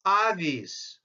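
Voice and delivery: avis with an open AH, "AH-vees"; Latin pronunciation. Shown as a demonstration. Lecturer giving the Latin reading believed correct, avis as AH-vees. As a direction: say avis the Latin way, starting on a broad AH. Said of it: "In Latin, this would be read as".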